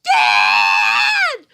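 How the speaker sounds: screaming for Dad at the top of her lungs; a little girl's terrified yell for help; panicked scream. Retold or imitated. Little girl screams for her dad in the woods, terrified. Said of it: "this is my reaction".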